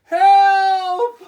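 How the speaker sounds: very high, very loud panicked shout; cry for help. Acted. Toad watching his list blow away, shouting. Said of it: "cried Toad".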